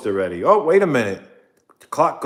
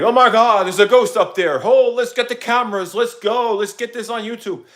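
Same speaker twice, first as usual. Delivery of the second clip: much higher and louder, gasping with thrill; overexcited ghost panic; 11 semitones higher, 5 dB louder than usual. Retold or imitated. Vlogger mocking his own ghost panic, high excited yelps. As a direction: hype yourself up over a ceiling pop. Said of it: "just getting overexcited".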